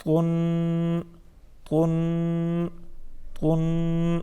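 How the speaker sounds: تن with a heavy, emphatic t, like "twun"; chanted تن, t made full and rounded; thick toa. Shown as a demonstration. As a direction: hold تن on one note with a thick t. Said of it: "اس کو موٹا پڑھتے ہیں".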